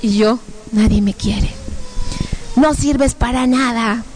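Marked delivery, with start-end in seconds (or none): 0.7-1.5 understated, defeated repetition; dejected echo. Retold or imitated. Woman echoing the demons' words, dejected. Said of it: "empiezo a escuchar mi voz". 2.5-4.0 slow, stretched-out taunt; horrible demon voice. Retold or imitated. Demons running her down, horrible voice. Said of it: "unas voces horribles que decían".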